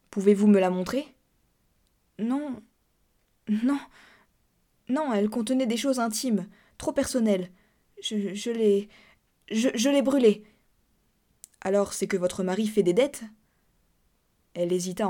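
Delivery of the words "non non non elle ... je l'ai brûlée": higher, halting, stammered excuses; flustered denial. Acted. Cousin lying to the narrator about the letter, flustered. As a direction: stumble into a hasty lie.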